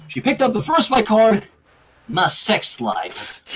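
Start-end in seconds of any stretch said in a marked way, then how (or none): 2.1-3.4 low, very slow deadpan. A narrator's reading. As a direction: drag it out dryly. Applejack reads card deadpan.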